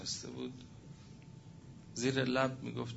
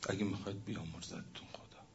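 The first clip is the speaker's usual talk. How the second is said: whisper; 2 semitones lower, 6 dB quieter, faster than usual. Acted. Preaching about secret good deeds.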